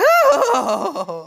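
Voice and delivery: high wicked cackle; evil cackle. A narrator's reading. Stepsisters cackling over their trick, high and wicked. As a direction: cackle like a gloating villain. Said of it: "The sisters laughed to each other".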